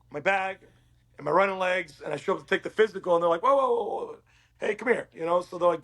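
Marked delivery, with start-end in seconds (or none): 3.4-5.1 higher-pitched imitation of alarmed officials; mock-stop someone in their tracks, pitched up; retold startled halt. Retold officials halting him at physical.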